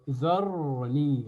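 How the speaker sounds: slow "dharni" with a thick, emphatic r; heavy ra. Shown as a demonstration. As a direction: stretch "dharni", backing the vowel before r. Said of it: "regardez comment je prononce".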